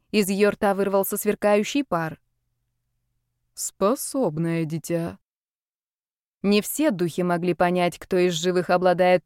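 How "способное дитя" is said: a lifeless stone statue, very slowly. Acted. Spirit judges the girl.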